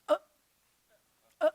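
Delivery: short throaty "Uh, uh", like a little burp. Shown as a demonstration. Teacher showing students the moving schwa sound. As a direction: clip a quick "uh" sound twice. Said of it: "it's gonna sound like this".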